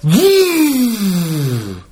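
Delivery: sputtering prayer bursting into a high whoop; hammed-up, wordless prayer cry; Ricky overexcited praying. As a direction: imitate someone too excited for words. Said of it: "Ricky gets so excited".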